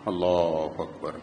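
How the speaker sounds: very low, soft, slow Allahu Akbar; a grave, whispered lament; hushed dismay. Real, not acted. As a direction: sigh it out in disbelief. Exclaiming in dismay.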